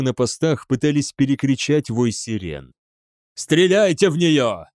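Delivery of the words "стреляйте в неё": raised, higher-pitched commanding shout; bellowed order. A narrator's reading. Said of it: "заорал Намаджира". (орёт приказ)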